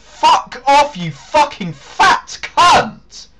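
furious high slow swearing; swearing tirade. Real, not acted. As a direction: scream the insults, drawn out. Shouting at another player.

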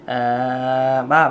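low chest-voice vocalise; chest voice demo. Shown as a demonstration. Vocal coach demonstrating chest voice. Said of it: "Vô giọng ngực, các bạn sẽ đi".

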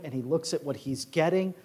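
ordinary talk

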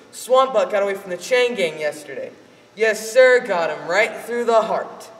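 loud, proud father bragging; father boasting to the men in the bar, loud. Acted. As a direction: announce it to the whole room.

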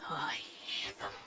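unvoiced, hushed whisper. Zood whispers of her lost sisters. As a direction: breathe it like a secret.

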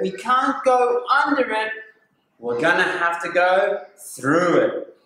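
loud, high, dramatic warning and chant; excited story reading. Acted. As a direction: read it big and thrilling for kids. Acts the hunt story aloud.

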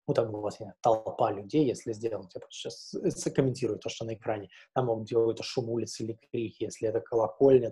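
usual speaking voice

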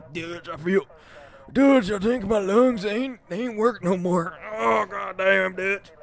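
slow, strained voice of a man whose lungs give out; mock dying outlaw. Streamer voicing a dying outlaw pleading to Dutch, slow and strained. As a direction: ham up a dying cowboy gasping to his boss.

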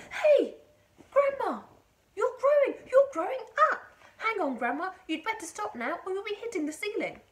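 high, loud cry of alarm; startled shout. A narrator's reading. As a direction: shout up a frantic warning to Grandma. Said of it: "gave a jump and shouted".

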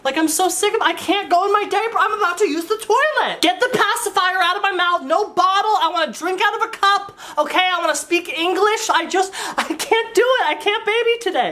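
high whiny complaint; whiny adult baby. Pretend. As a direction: whine like a fed-up baby. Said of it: "adult babies ... they go".

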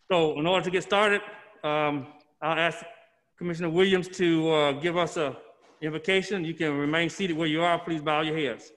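normal talking voice

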